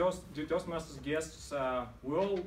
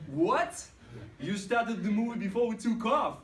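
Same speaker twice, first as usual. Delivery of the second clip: loud, high-pitched incredulous outburst; re-enacted indignant shock, voice shooting up; outraged disbelief; 8 semitones higher, 5 dB louder than usual. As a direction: shout it back in mock outrage. Passenger's outraged reply to the attendant.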